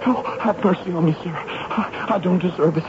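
high, breaking, desperate begging; pleading for mercy. Acted. Prisoner begging the major for pity, high desperate pleading. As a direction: plead like a broken man.

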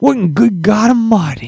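hammed-up old prospector. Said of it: "they're always like".

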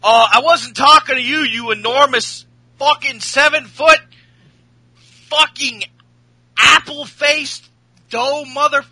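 mock angry rant. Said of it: "A J says".

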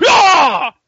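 sudden high loud yell; mock attack yell. Rick lunging at Negan mid-talk, a high loud mock yell. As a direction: burst out with a hammed-up battle cry.

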